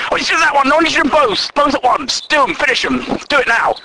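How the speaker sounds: high-pitched bossy orders in quick bursts; pushy know-it-all. Retold or imitated. Bossy colleague demanding both bugs fixed at once, pushy. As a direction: imitate a demanding pest piling on orders. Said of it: "he seems to talk down to everyone".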